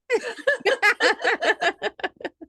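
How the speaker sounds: bursting into high laughter. Real, not acted. Laughing at request to sing.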